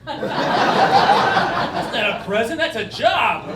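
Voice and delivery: loud, slow protest through laughter; loud mock complaint to his dad; indignant retort. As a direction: drag it out like an outraged kid.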